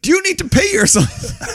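loud, very high bafflement; mock exasperated question. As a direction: demand it in comic disbelief. Acted question to whooping dream figure.

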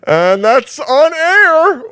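stretched out high and loud, alarmed; mock shocked. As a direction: drag it out in fake horror. Mock alarm about being recorded.

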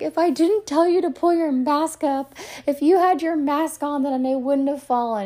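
high, loud retold scolding; mock accusation. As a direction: playfully blame your partner at full voice. Acted mock blame after her fall.

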